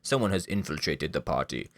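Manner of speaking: lightly voiced henchman, stiff and formal; a hint of a guard reporting to his boss; guard announcing an intruder, lightly acted. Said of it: "a guard walks in and says".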